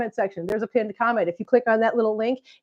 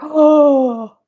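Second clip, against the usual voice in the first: mock offended gasp; 6 semitones higher, 7 dB louder, much slower than usual. Real, not acted. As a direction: gasp then a higher, louder mock-shocked "oh". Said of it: "that was rude".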